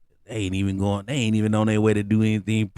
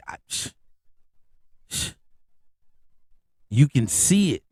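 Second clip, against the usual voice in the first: ordinary talk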